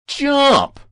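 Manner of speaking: high, loud urging cry; lone shout. A narrator's reading. Young voice from the crowd to the boy, shouting. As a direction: shout it up to the roof. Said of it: "was shouting and pleading for Ozzy to jump".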